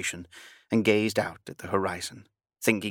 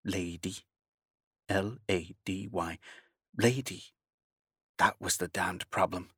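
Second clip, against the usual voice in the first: quiet bitter repetition; 6 dB quieter than usual. A narrator's reading. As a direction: mutter the word under the breath.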